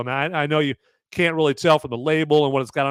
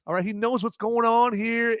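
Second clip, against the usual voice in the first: ordinary talk, nothing marked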